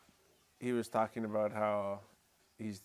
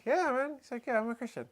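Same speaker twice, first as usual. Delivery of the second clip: much higher, beaming voice of the stranger; eager stranger; 12 semitones higher than usual. Retold or imitated. The stranger proudly confirming his faith, high and eager. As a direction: chirp it back proudly. Voicing the man's eager reply.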